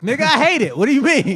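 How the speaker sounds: loud, high, indignant shouting; exasperated outburst. Real, not acted. Man fed up with holiday questions, exasperated shout. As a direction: shout it back, worked up. Heated rant about hating Thanksgiving.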